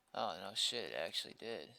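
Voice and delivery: usual speaking voice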